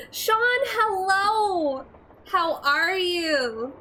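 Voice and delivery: high, slow, delighted hello; excited greeting. Real, not acted. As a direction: sing out a happy greeting. Greeting a viewer warmly.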